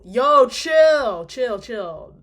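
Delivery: loud startled yell of chill; loud exclamation. Real, not acted. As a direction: shout it at something sudden. Reacting to something suddenly.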